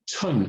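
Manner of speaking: "chun" with air let out on "ch"; aspirated ch. Shown as a demonstration. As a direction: puff into the start of "chun". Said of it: "But aspirate it".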